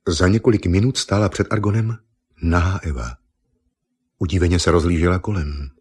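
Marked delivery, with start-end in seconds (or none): none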